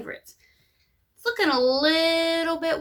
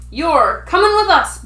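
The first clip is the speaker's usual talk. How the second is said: pirate captain; 4 semitones higher, 5 dB louder than usual. A narrator's reading. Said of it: "Braidbeard told me".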